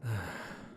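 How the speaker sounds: very quiet falling sigh; soft wistful sigh. Acted. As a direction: sigh gently, lost in memory. Pensive pause.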